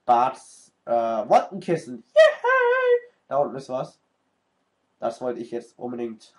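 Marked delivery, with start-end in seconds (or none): 2.1-3.1 very high squealing put-on yay; squeaky cheer. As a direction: squeal like a cartoon.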